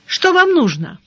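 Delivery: unfriendly call. A narrator's reading. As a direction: call out with no friendliness at all. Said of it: "без всякого дружелюбия крикнула". (недружелюбный окрик)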